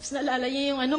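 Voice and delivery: ordinary talk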